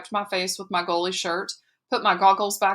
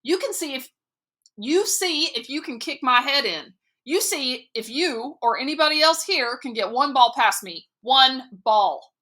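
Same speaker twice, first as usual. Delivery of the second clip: raised, higher furious challenge; defiant; 5 semitones higher than usual. A narrator's reading. Boy daring the coach to score on him. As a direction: dare him, voice up high.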